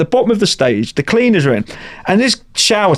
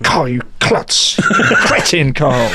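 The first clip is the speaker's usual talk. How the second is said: mock self-scolding; much slower than usual. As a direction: tell yourself off, half-joking. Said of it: "I was so mad at myself".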